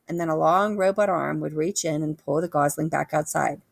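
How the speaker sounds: plain reading voice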